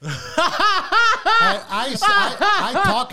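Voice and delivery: can't get it out for laughing. Real, not acted. Telling about French sleep-talk, real laughing.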